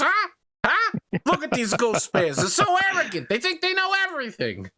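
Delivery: high, indignant ranting fan voice; high-pitched mock commentator rant; ranting Hell's Horses commentator. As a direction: squawk it out like an outraged superfan. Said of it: "and he's like".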